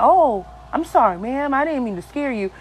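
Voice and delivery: usual speaking voice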